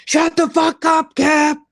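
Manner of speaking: high, loud imitated outburst; mock angry yell. Host imitating Julia's outburst at Cap, mock yell. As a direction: snap at Cap like she's lost it.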